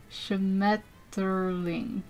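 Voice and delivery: "Schmetterling" very slowly, syllable by syllable; butchered German pronunciation. Real, not acted. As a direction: draw out "Schmetterling" as an unsure learner. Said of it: "I'm butchering this".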